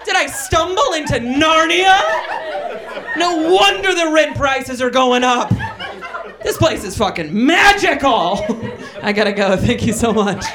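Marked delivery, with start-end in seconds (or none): 0.1-8.4 astonished onlooker. Retold or imitated. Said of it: "Be like".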